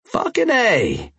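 high, slow, purring Cajun drawl; Cajun accent. Acted. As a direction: purr it in a thick Cajun accent. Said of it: "purred in his strong Cajun accent".

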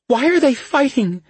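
high, loud tearful cry; weeping. Acted. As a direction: sob the question out. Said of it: "She wept into his chest".

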